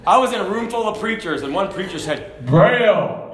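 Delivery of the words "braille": loud, confident blurted answer; with authority. Retold or imitated. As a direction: announce it with mock authority. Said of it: "he said it with authority".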